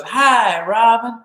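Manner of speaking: warm, high, loud delighted greeting; B B King's greeting. Retold or imitated. Guitarist imitating B B King saying hi, high and loud. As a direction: greet him like a beaming old bluesman. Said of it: "and he goes".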